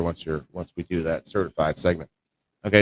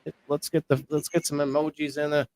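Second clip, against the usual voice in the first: higher and louder, pumped-up hype voice; hyped-up host; 6 semitones higher, 3 dB louder than usual. Acted. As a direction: hype the viewers like a stream host pushing for likes. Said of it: "Let's get that excitement back up".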